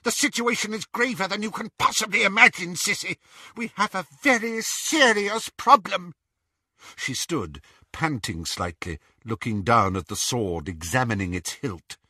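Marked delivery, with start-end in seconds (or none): 0.0-6.2 high, fast urgent witch. A narrator's reading. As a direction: press the danger quickly. Bellatrix voiced.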